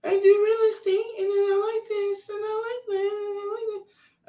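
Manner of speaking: very high squeaky babble; mock her chatter in a tiny falsetto; singsong, super-high imitation of a woman rambling; mimicked chatty date. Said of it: "the girl's talking and she's like".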